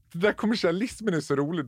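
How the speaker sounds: usual speaking voice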